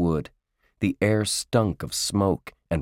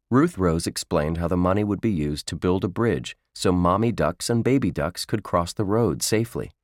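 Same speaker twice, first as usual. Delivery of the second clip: usual speaking voice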